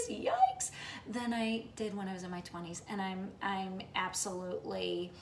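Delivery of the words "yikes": sudden high squeaky yikes; playful cringe on one word; mock yikes. Real, not acted. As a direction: yelp it in jokey alarm at your age. Mock dismay at turning sixty.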